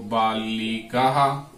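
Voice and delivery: बालिका drawn out very slowly for the class; slow dictation. Shown as a demonstration. Teacher dictating बालिका to students, very slow. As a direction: stretch out बालिका so students can write it. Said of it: "तो क्या कह रहा है देखो".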